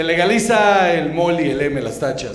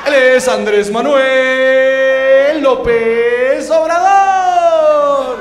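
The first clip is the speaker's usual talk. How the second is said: hype announcer; 3 semitones higher, slower than usual. Pretend. Comic as the emcee introducing AMLO to a drugged crowd, hammed-up hype. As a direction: announce the star like a boxing emcee. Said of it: "Yo voy en el micrófono y dice".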